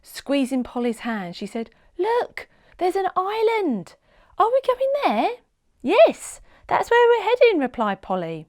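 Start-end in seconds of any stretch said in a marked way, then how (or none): 2.0-5.3 put-on much higher, eager child's voice; girl spotting an island at dawn; excited girl. A narrator's reading. 5.8-7.6 much higher, louder, bright answer; cheery superhero. A narrator's reading. Polly answers excitedly.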